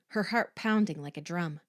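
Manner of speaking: ordinary delivery, nothing marked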